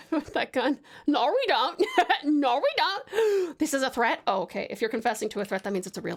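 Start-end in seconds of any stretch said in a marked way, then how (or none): 1.1-1.8 "No" drawn into an Australian "nor"; teasing Australian "No" with an r-coloured ending; mock Aussie "nor". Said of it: "you guys do say "nor". 2.2-3.6 "No" said as an Australian "nor", higher pitched; exaggerated Aussie "No" ending in r; mock Aussie "nor". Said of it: "you guys do say "nor".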